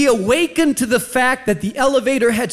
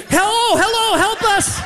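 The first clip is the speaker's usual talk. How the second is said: high, mock call for help; 13 semitones higher than usual. Calling out, stuck in elevator.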